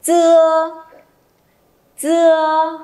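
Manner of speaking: high "Chưa", held out on a level tone; loud model syllable. Shown as a demonstration. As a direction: project "Chưa" evenly, pausing between repeats. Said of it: "Đọc to lên nào".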